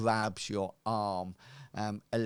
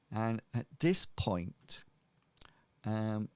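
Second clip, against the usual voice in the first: normal talking voice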